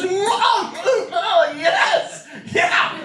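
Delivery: high laughing whoop of approval; mock cheer. To the nephew, a hyped, laughing cheer. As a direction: cheer him on like a hype man.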